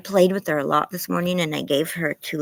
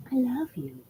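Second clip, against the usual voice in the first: high, softer pet voice love you; 8 semitones higher, 5 dB quieter than usual. Real, not acted. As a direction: coo it to the dog. Tender words to dog.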